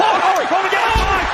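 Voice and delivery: loud, high excited shout at the ring; excited yell. Real, not acted. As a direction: yell it over the crowd noise. Commentator yells as chaos erupts.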